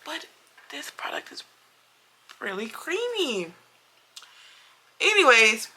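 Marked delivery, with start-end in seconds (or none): 0.1-3.5 hushed. Real, not acted. Vlogger quietly admitting the elf product impressed her, whispered. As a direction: confide it like a secret.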